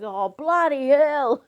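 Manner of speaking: loud, high, drawn-out exclamation; startled outburst. Retold or imitated. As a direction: recoil in exaggerated alarm. Startled man dodging gushing water.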